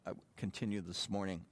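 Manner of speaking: usual speaking voice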